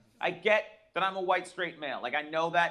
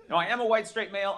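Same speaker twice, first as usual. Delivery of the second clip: usual speaking voice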